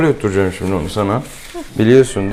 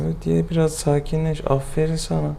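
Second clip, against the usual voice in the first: soft, high singsong pet talk; soothing praise; 6 semitones higher, 6 dB quieter than usual. Real, not acted. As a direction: croon encouragement to a nervous cat. Vet coaxing cat to eat. (yumuşak, tiz sesle yatıştırma)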